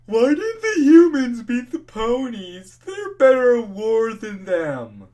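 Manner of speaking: high, slow, dopey sneer of a complainer; high mocking complainer voice; mocking commenter voice. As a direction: mimic the whiny commenter, drawn out and loud. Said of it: "I see people complaining about".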